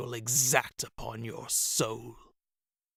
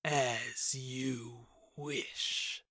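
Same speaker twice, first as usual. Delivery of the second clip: very slow, much softer contemptuous spit; spat; 7 dB quieter, much slower than usual. A narrator's reading. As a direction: spit the words out in cold contempt. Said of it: "the king spat".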